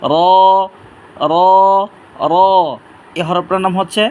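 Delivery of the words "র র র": র with a heavy, full-mouthed sound; thick, heavy. Shown as a demonstration. Teacher models a letter for learners, thick and heavy. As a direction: thicken the R and vowel of র. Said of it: "মোটা করে পড়বো".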